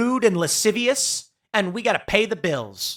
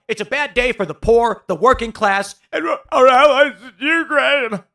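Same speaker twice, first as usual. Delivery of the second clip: louder, choking mock sob on Ukraine; mock tearful Kimmel; 5 semitones higher, 6 dB louder than usual. Imitating Jimmy Kimmel's teary monologue, hammed-up mock sob. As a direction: ham up a host fighting back tears. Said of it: "couldn't mutter out the word Ukraine without crying".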